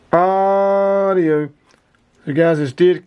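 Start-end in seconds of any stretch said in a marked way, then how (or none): 0.1-1.5 long, raised "Audio" called into the mic; drawn-out radio test call. Real, not acted. Keying the radio for the power test, a long raised call. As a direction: stretch it out like a mic check.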